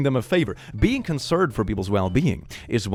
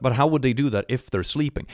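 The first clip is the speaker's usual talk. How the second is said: ordinary talk, nothing marked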